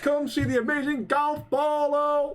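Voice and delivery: hammed-up fairground barker; mock carnival barker. Friend quoting the carnival barker, hammed-up barker call.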